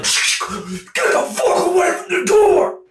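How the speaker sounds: very high, slow screamed threat at the door; furious shout. Retold or imitated. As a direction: scream at whoever's knocking. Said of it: "I'd be like".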